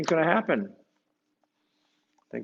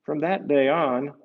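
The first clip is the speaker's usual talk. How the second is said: story narration; 7 semitones higher, 8 dB louder than usual. Acted. Reading aloud to children, lively storyteller. Reads picture book aloud.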